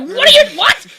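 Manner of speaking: loud, high baffled outburst; mock disbelief. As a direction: yelp it in exaggerated bewilderment. Acts own baffled outburst.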